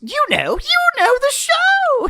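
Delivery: climbing high and loud on the show; excited insistence. Acted. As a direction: pitch it up eagerly.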